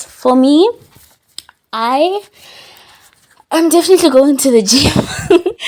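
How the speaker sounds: loud, high and eager; excited. Real, not acted. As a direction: announce it with a big grin. New Year gym resolution.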